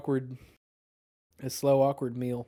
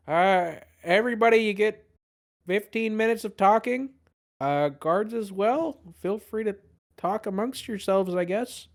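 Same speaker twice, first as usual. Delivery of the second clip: higher, slowish deflated announcement; first mate reluctantly telling prisoners they may talk, higher pitched; sheepish first mate; 8 semitones higher than usual. Acted. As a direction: give the permission grudgingly. Gallium relays captain's order.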